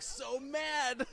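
high, drawn-out mock outrage; mock exasperation. Author describing his sulking kid character, in mock outrage. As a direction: stretch it out high, comically aggrieved. Describes monologue kid's exaggerated anger.

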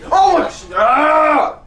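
high, loud startled shriek; startled scream. Real, not acted. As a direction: scream at the jump scare. Jump scare in horror game.

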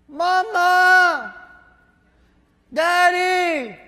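put-on high, loud, drawn-out child's call; crying out. Lost child calls for parents in the aisle, crying out. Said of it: "you cry out their names".

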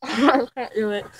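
slow, muffled by marshmallows; mouth full. Real, not acted. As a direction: give up through a full mouth.

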